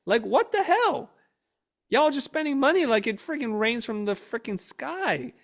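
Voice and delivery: loud, high, drawn-out outburst; exasperated dad yell. Retold or imitated. Dad scolding family for spending money like crazy, exasperated yell. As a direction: holler in indignant disbelief, stretching the words.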